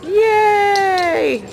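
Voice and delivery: high, happy cheer; excited cheer. Real, not acted. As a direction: cheer the dog on. Cheering the dog on.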